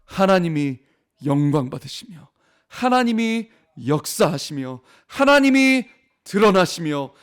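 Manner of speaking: impassioned preacher driving home the point; full-force preaching. Real, not acted. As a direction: build the sermon to its climax. Climactic preaching to the congregation.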